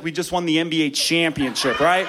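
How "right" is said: ordinary talk, nothing marked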